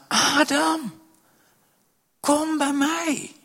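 high, slow, drawn-out invitation; God's gentle call. Acted. God inviting Adam to come near. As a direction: call him home, slowly and high.

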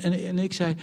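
ordinary talk

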